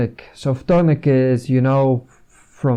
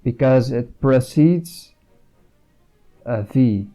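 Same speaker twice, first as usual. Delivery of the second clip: normal talking voice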